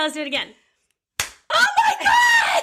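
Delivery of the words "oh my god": very high, drawn-out squeal; amazed shout. Real, not acted. Co-host amazed by high five trick, real shriek. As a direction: shriek slowly in delighted disbelief.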